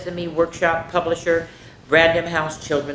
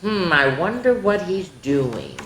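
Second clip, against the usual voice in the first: ordinary delivery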